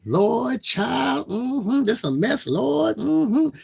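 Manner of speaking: high, loud mock mammy voice; exaggerated high mammy impression full of mm-hmms. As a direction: ham up a churchy old auntie, Lord child. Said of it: "And she talked like this".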